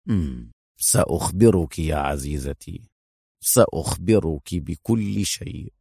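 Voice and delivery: low, slow, smiling hero voice; mysterious smile. A narrator's reading. Hero teasing his worried companion, low and smiling. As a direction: promise it with a knowing smile, slowly. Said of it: "ارتسمت على شفتيه ابتسامة غامضة".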